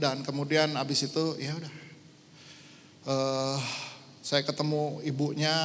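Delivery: ordinary talk, nothing marked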